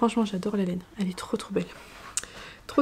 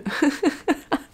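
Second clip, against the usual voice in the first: higher giggling burst of laughter; embarrassed laugh; 4 semitones higher than usual. Real, not acted. Knitter tripping over an English hashtag, giggling. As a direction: laugh at your own botched English.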